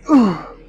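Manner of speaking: high, loud effort groan; loud straining groan. Real, not acted. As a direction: let out the groan as the set ends. After a heavy set.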